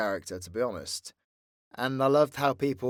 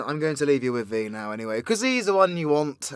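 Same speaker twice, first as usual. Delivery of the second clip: usual speaking voice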